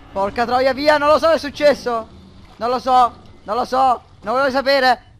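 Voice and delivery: running away in terror; panicked yelling. Real, not acted. Fleeing monster in game.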